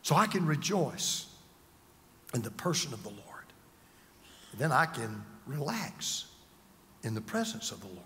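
very soft, low, with long pauses; hushed calm. Real, not acted. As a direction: relax into a near-murmured assurance. Preacher models resting in God's presence.

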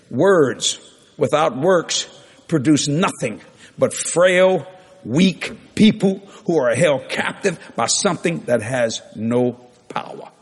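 measured words with long pauses; slow dictation. Real, not acted. Preacher gives congregation a point to write down, slow dictation.

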